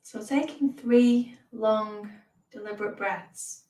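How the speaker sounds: measured meditation voice with long pauses; guided meditation. Real, not acted. Teacher leads beginners through mindfulness and breathing, guided meditation.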